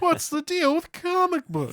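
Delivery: high, exaggerated observational-comedy whine; Jerry Seinfeld. Co-host joining in a joke about comic books, bad comedian impression. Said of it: "like a bad Jerry Seinfeld impression".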